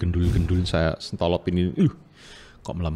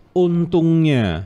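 Untungnya stretched very slowly, higher and louder; drawn-out stress; 7 semitones higher, 5 dB louder, much slower than usual. Real, not acted. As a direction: lean hard on Untungnya, drawing it out.

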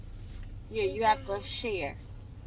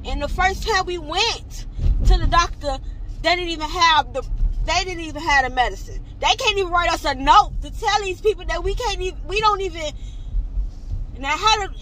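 loud, high, exasperated complaint; raised, upset outburst; flustered anger; 7 semitones higher, 6 dB louder than usual. Real, not acted. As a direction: pile up grievances, voice climbing. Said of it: "I'm all over the place".

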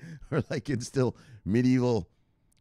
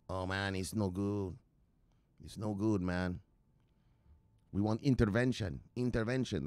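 imitating the Cuban guy; 6 dB quieter than usual. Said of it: "that's how the guy talks".

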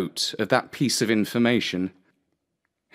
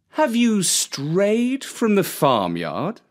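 slow, higher, oily fox; sly fox; 9 semitones higher, slower than usual. Acted. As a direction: ask as if idly curious. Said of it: "inquired the fox".

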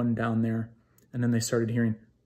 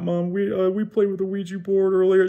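high, louder, panicked confession; freaking out; 8 semitones higher, 5 dB louder than usual. Retold or imitated. Scared son waking mom to confess, panicked and high. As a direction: blurt it out to mom like a scared kid. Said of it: "I was freaking out".

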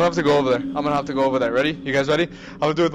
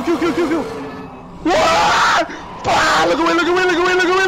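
high, loud panicked screaming; terrified screaming; 11 semitones higher, 6 dB louder than usual. Real, not acted. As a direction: scream it like you're being chased. Monster chase, shouting.

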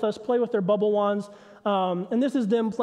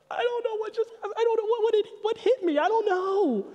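put-on high, small, bewildered kid voice; betrayed toddler; 11 semitones higher than usual. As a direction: sound like a two-year-old who feels betrayed.

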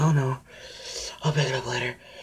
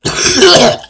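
pained high sigh; 12 semitones higher, 13 dB louder than usual. Real, not acted. After tasting hot sauce.

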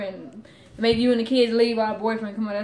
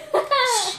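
loud, high burst of laughter; mocking laugh; 11 semitones higher, 5 dB louder than usual. Real, not acted. Girlfriend laughs at her angry boyfriend, mocking laugh. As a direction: laugh right at him.

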